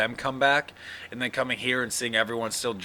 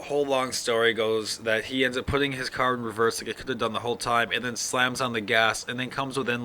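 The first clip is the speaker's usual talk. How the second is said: ordinary talk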